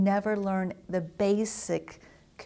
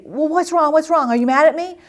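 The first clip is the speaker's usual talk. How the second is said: put-on high, loud, worried little kid; a kid pleading to know if he's in trouble; child asking an upset parent, high and loud with worry; anxious child; 9 semitones higher, 12 dB louder than usual.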